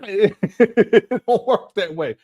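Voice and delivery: slow, laughing it out; laughing through it. Real, not acted. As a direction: laugh the words, drawn out. Laughing at his own point.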